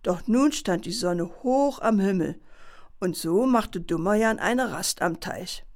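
ordinary delivery

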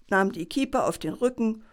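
plain reading voice

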